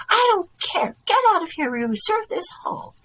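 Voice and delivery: high, loud angry cry; angry woman. A narrator's reading. Woman refuses to give up the hall, screaming angrily. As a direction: cut him off in a high-pitched rage.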